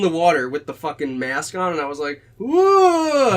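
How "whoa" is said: high, loud retold yelp of fright; hammed-up fright underwater; startled whoa. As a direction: relive the shock of the eel in a loud high yelp. Startled by eel in water.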